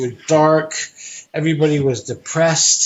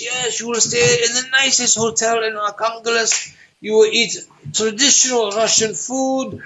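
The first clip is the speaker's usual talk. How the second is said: put-on translator's stiff, lightly accented English, a bit higher; mild Russian accent; 8 semitones higher than usual. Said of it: "She says".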